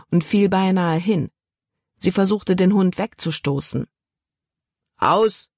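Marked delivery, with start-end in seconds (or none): 5.0-5.5 firm, loud command to a dog; short barked order; sharp command. A narrator's reading. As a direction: snap it out like a captain ordering his dog. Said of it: "befahl der Kapitän".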